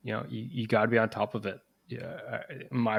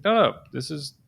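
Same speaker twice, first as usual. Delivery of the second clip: louder, higher, protective team-leader reproof; firm intervention; 4 semitones higher, 5 dB louder than usual. Acted. Team leader to those chuckling at a colleague, firm intervention. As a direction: step in firmly for a teammate, raised pitch.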